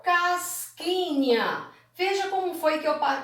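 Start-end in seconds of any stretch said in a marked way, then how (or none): 0.0-1.7 CASQUINHA stretched slowly, higher; syllable by syllable. Shown as a demonstration. Teacher separating the word casquinha for pupils, slow syllables. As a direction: draw out each syllable of casquinha. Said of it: "eu separei a palavra casquinha".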